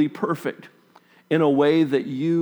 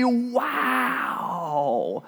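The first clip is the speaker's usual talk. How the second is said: awed wow; 9 semitones higher, 4 dB quieter than usual. Retold or imitated. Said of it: "You would think his natural response would be".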